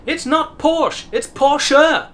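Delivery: high, loud, emphatic correction; Joey insisting. Retold or imitated. Teacher imitating a sitcom character boasting, insistent. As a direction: insist loudly, pitch jumping up. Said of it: "constantly insisting".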